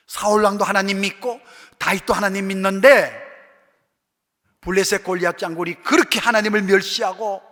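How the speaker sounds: preacher building to a climax before the church; fiery preaching. Acted. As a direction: pound the point home from the pulpit. Preaching at full force.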